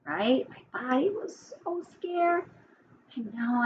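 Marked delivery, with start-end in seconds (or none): 0.7-2.4 softer, higher, as if frightened; hushed pretend fear, pitched up; scared. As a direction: mildly play-act being scared in a hushed high voice. Voicing a scared body, with children.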